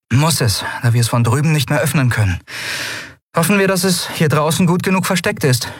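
Endriel in dialogue. Acted.

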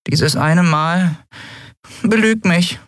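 slow, high little creature plea; tiny creature, wearily pleading; weary Nelen. A narrator's reading. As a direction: plead slowly in a small, very high voice. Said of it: "antwortete Nelen matt".

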